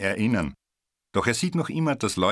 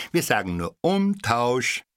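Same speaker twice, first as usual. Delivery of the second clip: needling; 5 semitones higher than usual. A narrator's reading. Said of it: "stichelten sie".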